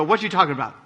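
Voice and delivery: a casual nod to the TV line, barely voiced; Different Strokes catchphrase. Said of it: "borrow the different strokes term".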